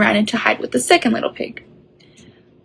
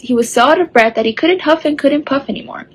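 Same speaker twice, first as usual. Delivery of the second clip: plain reading voice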